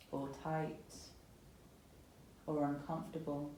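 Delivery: calm, unhurried yoga meditation voice with long pauses; guided meditation. Acted. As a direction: gently guide them into settling and softening, phrase by phrase. Guides breathing and body scan.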